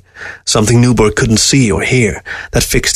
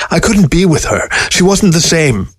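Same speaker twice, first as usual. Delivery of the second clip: voice breaking; 8 semitones higher than usual. A narrator's reading. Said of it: "He found his voice breaking".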